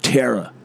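"Terror", the Americanised Tara; American pronunciation. Shown as a demonstration. Mocking the American version, American pronunciation. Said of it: "They say it".

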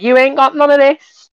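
sassy taunt. Retold or imitated. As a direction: tease them like a street gang. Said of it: "saying, like".

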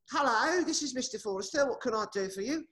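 high put-on gentleman voice; fake Mister Forrester. Pretending to be Forrester for telemarketer, high put-on voice. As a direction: pose as a pompous Mister Forrester. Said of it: "get on the thing and say".

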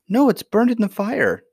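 put-on distressed child. A narrator's reading. Princess telling grandmother the ball burned, distressed. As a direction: lament it in a high girlish voice.

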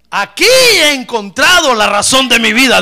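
forceful, high pulpit cry; triumphant declaration. Acted. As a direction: proclaim it as a convert's great discovery. Preacher voices Saul's joyful declaration.

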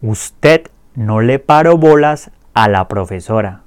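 clear repeat-after-me drill. Shown as a demonstration. Teacher drills the conjugations for students to repeat, clear and steady. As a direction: say each line clearly for learners to echo. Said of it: "Repitan después de mí".

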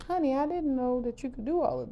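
high, impressed husband voice; playing the husband, pleasantly surprised; husband's praise. Husband praising her cooking, high and impressed. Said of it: "he's like".

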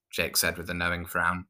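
ordinary delivery, nothing marked

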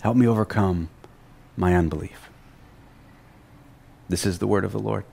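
usual speaking voice

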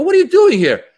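loud, high-pitched disbelief; high yelled surprise at a familiar face; shocked shout. Retold or imitated. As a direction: shout it, stunned to spot your friend out there. Startled to see a friend in audience.

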